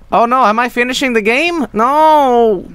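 high loud mock dismay; alarmed. Real, not acted. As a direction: cry out in fake panic. Mock alarm at ending game.

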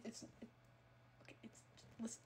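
sheepish whispered listen. Real, not acted. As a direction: hush it out guiltily.

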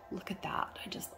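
hushed whisper. Real, not acted. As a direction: breathe it softly in awe. Whispered aside while admiring kit.

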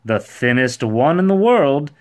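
loud, high, cheery reply; bright. A narrator's reading. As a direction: answer brightly. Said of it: "he replied brightly".

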